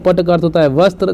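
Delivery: ordinary talk, nothing marked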